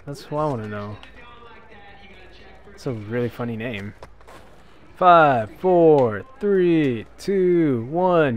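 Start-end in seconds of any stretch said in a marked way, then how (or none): 5.0-8.4 loud countdown. Real, not acted. Counting down loudly.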